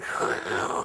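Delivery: breathy whoosh sailing overhead; whooshing noise. Retold or imitated. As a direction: imitate something whooshing past. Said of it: "it just went".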